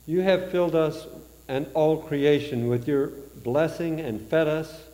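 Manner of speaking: usual speaking voice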